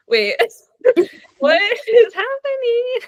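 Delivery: high, laughing "what is happening"; laughing disbelief. Retold or imitated. Reader reacting to a book twist, laughing in disbelief. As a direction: squeal it through giggles. Retold shock while reading.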